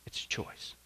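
whispered; whispered final point to the church. Real, not acted. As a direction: breathe it out as a secret. Preacher's hushed closing point.

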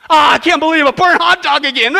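loud, high camper's complaint; mock whining outburst. Preacher acts camper griping at a burnt meal, mock whining outburst. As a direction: wail in hammed-up dismay.

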